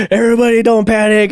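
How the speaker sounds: high-pitched mock panic; hammed-up plea against panic; panicky calming. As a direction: imitate a boss failing to keep everyone calm. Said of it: "and they're all panicking".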